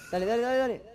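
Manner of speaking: excited urging. Real, not acted. Urging teammate in fight.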